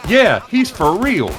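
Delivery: high, stretched-out mock agreement; cheeky drawn-out interjection; retold smart-aleck chime-in. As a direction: chime in loudly and slowly to back him up. Friend smart-mouths the angry teacher.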